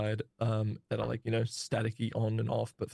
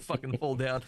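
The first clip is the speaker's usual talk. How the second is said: ordinary delivery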